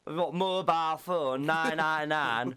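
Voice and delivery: broad Hull accent, drawn out; slow, broad Hull vowels. Shown as a demonstration. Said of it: "Do a proper Hull accent".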